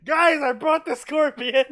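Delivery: high, loud and laughing; laughing triumphant shout. Retold or imitated. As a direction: announce it through laughter. Punchline, laughing while quoting.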